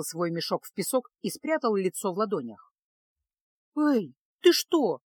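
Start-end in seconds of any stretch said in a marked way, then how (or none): 3.7-5.0 high, loud, drawn-out startled call; frightened girl's cry; alarmed. A narrator's reading. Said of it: "испугалась девушка".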